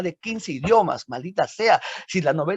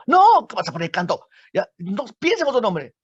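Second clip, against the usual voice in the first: emphatic rejection; 5 semitones higher, 4 dB louder than usual. Acted. Friends rejecting second title.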